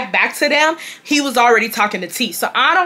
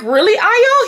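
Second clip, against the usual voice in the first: mock surprise; 11 semitones higher, 4 dB louder, slower than usual. Surprised at being handed bottle.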